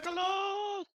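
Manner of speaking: high, loud drawn-out cry; shouted call. Pretend. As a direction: belt it out high and long. Player bursts out with a called name.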